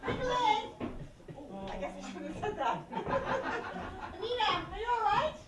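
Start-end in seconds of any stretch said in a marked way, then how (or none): 0.1-0.6 loud, high name call. Acted. Waitress hollering for Nicholas, calling out.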